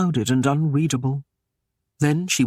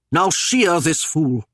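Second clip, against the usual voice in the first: commanding; 3 dB louder than usual. A narrator's reading. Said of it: "he bade them".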